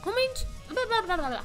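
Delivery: high, loud excited squealing. Real, not acted. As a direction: burst out in giddy excitement. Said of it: "Sorry, I got so excited".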